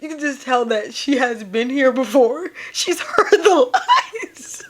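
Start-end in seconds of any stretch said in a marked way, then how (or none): 2.7-4.7 very slow, high laughing wail; words dissolving into real laughter; crying with laughter. Real, not acted. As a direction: stretch it out into helpless laughter. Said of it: "I am cry".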